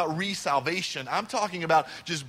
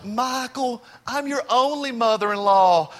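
higher-pitched, exasperated Southern impression; mother-in-law's Southern accent; 4 semitones higher, 4 dB louder than usual. Mother-in-law correcting her son-in-law, real Southern accent. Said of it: "my mother-in-law talks real Southern".

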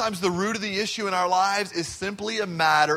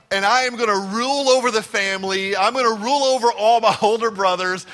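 mock-boastful, building louder; 3 semitones higher, 6 dB louder than usual. Joseph telling his envious brothers his dreams, loudly boastful. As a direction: play the cocky younger brother, voice raised.

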